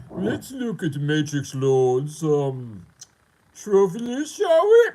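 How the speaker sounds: high, loud, drawn-out mock inspection; hammed-up scoffer, climbing to a loud high finish; mocking snob. Critic looking over his trophy list, mocking.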